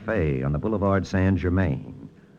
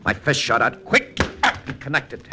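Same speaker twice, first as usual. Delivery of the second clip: excited fight narration; 13 semitones higher than usual. A narrator's reading. Detective recounting the alley fight, rising excitement. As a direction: voice climbing higher and higher as the fight heats up.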